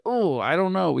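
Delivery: high, helpless "Oh, I don't know"; mock bewildered parent; flustered. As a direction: throw up your hands, lost.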